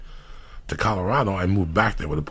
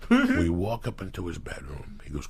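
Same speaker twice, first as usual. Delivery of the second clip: ordinary talk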